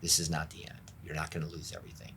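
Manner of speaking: hushed, low, tender assurance; quiet, intimate voice of comfort; the Lord speaking. Retold or imitated. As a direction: reassure very softly, almost whispering. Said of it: "what the Lord was speaking to me".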